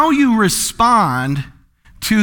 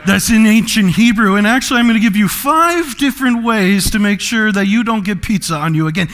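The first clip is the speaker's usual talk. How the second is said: know-it-all teacher. Acted. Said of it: "You're a teacher".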